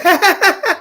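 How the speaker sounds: high, imitated nervous chuckle; nervous laugh. Eric after being threatened, high nervous laugh. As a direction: mimic someone laughing nervously. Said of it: "you get that nervous laugh".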